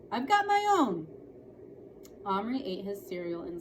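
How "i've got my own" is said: put-on retorting boy. Brother retorting.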